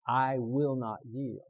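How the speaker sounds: slow, firm declaration; leading a recitation. Shown as a demonstration. Preacher giving the congregation a vow to repeat, slow firm lead. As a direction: lay it down word by word. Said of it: "And then repeat with me".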